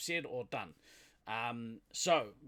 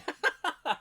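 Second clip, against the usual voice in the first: burst of laughter; 8 dB louder than usual. Real, not acted. Laughing at Steve-O joke.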